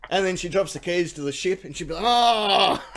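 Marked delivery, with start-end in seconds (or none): 2.1-2.8 loud mock wail of dismay. Pirate dropping the keys to the ship, loudly dismayed. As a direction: cry out in exaggerated dismay.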